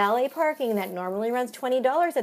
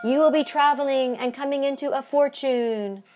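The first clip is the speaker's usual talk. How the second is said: fortune-teller read; 5 semitones higher, 4 dB louder than usual. Acted. Reading fortune.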